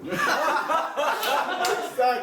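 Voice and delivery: loud high burst of laughter; big laugh. Real, not acted. Laughing loudly at his own joke with friends. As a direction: crack up at your own line.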